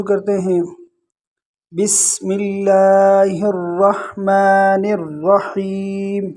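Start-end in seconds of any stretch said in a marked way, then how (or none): 1.7-6.3 slow melodic tarannum recitation; Quranic recitation. Shown as a demonstration. Teacher opens the lesson with slow chanted recitation. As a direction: draw each word out in a chanted melody.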